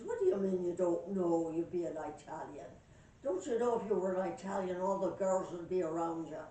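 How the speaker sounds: loud Irish pub banter; Irish accent. Acted. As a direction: argue loudly with an Irish accent. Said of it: "I got me an Irish accent".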